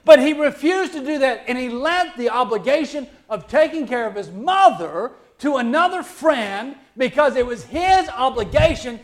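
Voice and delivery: loud, high-pitched sermon delivery; impassioned preaching. Real, not acted. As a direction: preach it hard, leaning on the key words. Preaches at full force.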